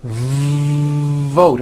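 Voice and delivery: VOTE with a long buzzing V before the vowel; stretched V. Shown as a demonstration. Teacher showing long V, buzzing stretched VOTE. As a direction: hold the V buzz, then release into VOTE. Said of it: "Are you hearing that long V sound?".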